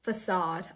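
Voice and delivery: Facade as "fuh-SAHD"; correct pronunciation. Shown as a demonstration. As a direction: say Facade with a long ah. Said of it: "This is facade".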